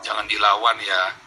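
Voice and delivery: slow, hushed fatherly reassurance; father's gentle advice. Retold or imitated. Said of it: "ayah saya selalu bilang apa?".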